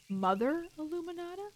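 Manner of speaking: amused surprise. Real, not acted. Player marvelling at the nun's promotion, surprised. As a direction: echo "Mother Illuminata?" as a bemused question. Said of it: "ooh, she's been promoted".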